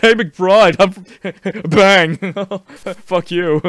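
high mock taunt breaking into laughter; laughing re-enactment. Player imagining shooting McBride, laughing mock taunt. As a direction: play the taunt, cracking up.

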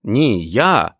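put-on loud childish cry; loud kid's protest; boy's exclamation. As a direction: cut in like a competing boy. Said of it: "вигукував Майкл".

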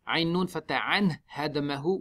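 ordinary delivery

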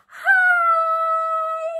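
high delighted greeting; excited hi. Pretend. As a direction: squeal hi to a best friend. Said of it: "and said".

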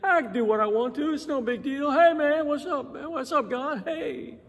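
high, breezy casual bro voice; careless dude. Retold or imitated. Preacher mocking a careless believer greeting God like a pal. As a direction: toss it off like God is a buddy. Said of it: "you don't just say".

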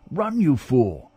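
mild urgent command. A narrator's reading. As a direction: order him to run, pressing each word. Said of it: "Bugbear commanded".

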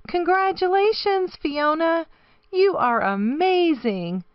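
gushing, high-pitched congratulations; excited fan mail. A narrator's reading. Fans writing to a little baby hippo, excited and high. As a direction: cheer her on with admiring delight.

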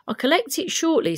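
hurried messenger promising to return, high and loud. Acted. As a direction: rattle it off as the busy messenger. Messenger voiced in story.

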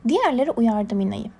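ordinary delivery

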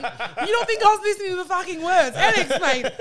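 loud, high-pitched indignant protest through laughter; real outburst, high and loud, half laughing. Real, not acted. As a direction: protest loudly at being teased. Defends herself amid laughter at her slip.

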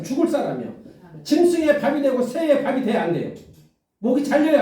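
fiery preaching. Acted. Pastor declaring Goliath's doom to the church, emphatic. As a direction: proclaim it as certain fact.

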